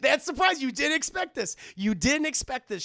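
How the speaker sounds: loud, high excitement after a laughing fit; gleeful gloating. Real, not acted. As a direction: crow triumphantly at the chat.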